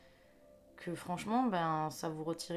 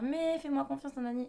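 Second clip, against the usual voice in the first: mock pleading; 8 semitones higher, 5 dB louder than usual. Voicing the returning person.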